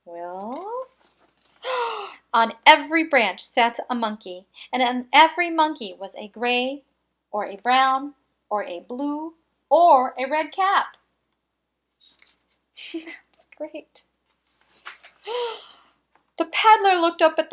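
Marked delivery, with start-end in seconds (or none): 1.6-2.3 sharp high gasp of discovery; surprised gasp. A narrator's reading. Narrator to the children, a sudden high gasp at the monkeys. 14.8-15.6 high theatrical gasp; mock gasp. A narrator's reading. Narrator playing up the surprise for children, a high gasp.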